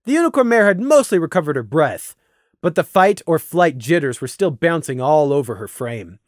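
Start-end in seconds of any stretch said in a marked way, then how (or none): none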